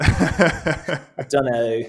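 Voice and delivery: slow, laughing mock Scottish accent; hammed-up Scottish accent, very slow. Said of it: "That was a great Scottish accent".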